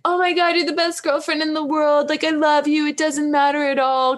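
high, gushing mock boyfriend voice. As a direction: overdo the adoring boyfriend. Mocking an over-gushing boyfriend.

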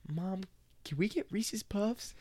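put-on high, slow pleading kid; child voice. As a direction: beg sweetly like a little kid. Child asking mom.

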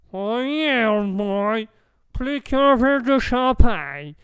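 put-on loud, high American-accented French; hammed-up boozy American, words dragged out; drunk American. American offered time to sober up, drunken American accent. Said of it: "laisser à l'américain de dessouler".

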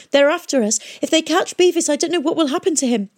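high, urgent plea; pleading cry. Acted. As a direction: beg her, frightened and loud. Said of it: "she cried".

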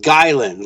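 "Guyland" slowly, with a hard G up front; slow, deliberate "Guyland"; phonetic demonstration. Shown as a demonstration. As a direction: spell out the sound of "Guyland". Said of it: "like G U Y L A N D".

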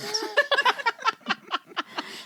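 real high burst of laughter; high, helpless giggling. Real, not acted. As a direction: laugh out loud in a long peal. Laughs at the mom quote.

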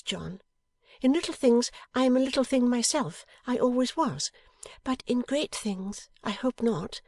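high-pitched, emphatic wifely pledge; Bella, devoted wife. A narrator's reading. Wife promises perfect faith to husband, earnest.

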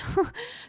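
high burst of laughter. Real, not acted. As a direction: giggle up into a squeal. Laughs after exclamation.

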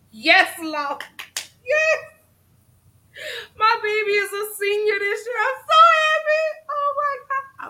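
half-sing it, bursting with pride; proud mother shouting with joy over her senior son; overjoyed. Real, not acted.

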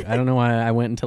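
usual speaking voice

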